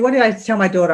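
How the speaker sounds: ordinary talk, nothing marked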